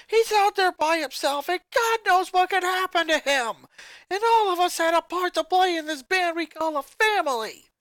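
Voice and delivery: high, loud furious scolding; enraged. Acted. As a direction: tear into her sister with fury. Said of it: "The musician became enraged".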